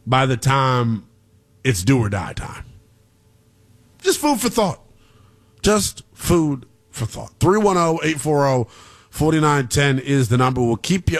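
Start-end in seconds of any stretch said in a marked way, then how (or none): 4.0-4.8 quick, much higher aside; high-pitched aside. Real, not acted. Host teasing listeners about the offense, high-pitched aside. 5.6-7.3 slow, word by word, in usual pitch; slow, deliberate repeat. Real, not acted. Host repeating his point to listeners, slow and deliberate.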